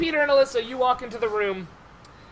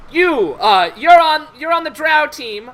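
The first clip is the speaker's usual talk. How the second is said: loud, higher official voice; team announcer; 5 semitones higher, 8 dB louder than usual. Acted. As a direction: call out the assignment forcefully. Game master voices an official assigning teams.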